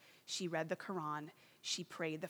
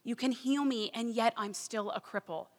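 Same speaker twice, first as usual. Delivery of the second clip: louder pleading cry to heaven; 3 semitones higher, 8 dB louder than usual. Retold or imitated. Desperate woman crying out to Jesus, louder plea. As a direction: call out urgently, begging for healing. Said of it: "She starts crying out".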